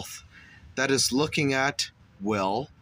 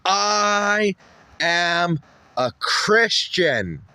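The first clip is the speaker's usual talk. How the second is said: higher, louder, slow pious declaration; mock preacher; 7 semitones higher, 6 dB louder, much slower than usual. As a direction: proclaim it like a sermon. Mock preachy self-righteous claim.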